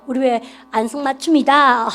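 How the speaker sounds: usual speaking voice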